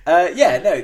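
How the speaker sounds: normal talking voice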